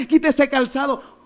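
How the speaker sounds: high-pitched, insistent exhortation; urgent preached command. Real, not acted. Preacher urges the congregation to remove it, urgent preached command. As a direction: press "quita ese calzado" home, pitched high.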